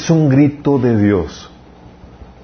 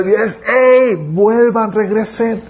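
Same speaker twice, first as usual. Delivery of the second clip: voice of God calling; 8 semitones higher, much slower than usual. Acted. God calling people back, voiced.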